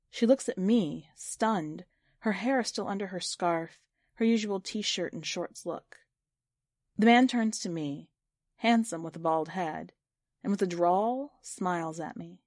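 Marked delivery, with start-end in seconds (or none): none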